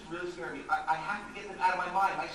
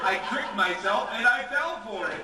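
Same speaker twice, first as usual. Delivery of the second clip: laughing; 2 semitones higher, 8 dB louder than usual. Acted. Laughing at his own trick.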